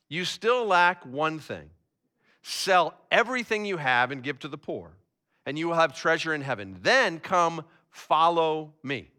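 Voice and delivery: scripture reading. A narrator's reading. Preacher reading Jesus and the rich ruler to the congregation, measured scripture reading. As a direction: read the passage solemnly, letting lines settle downward. Reads the Bible passage aloud.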